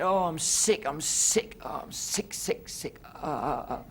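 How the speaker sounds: high moaning then whispered sick squid; fading to a whisper; whimpering squid. Acted. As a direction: groan like a sick little squid. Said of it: "He said".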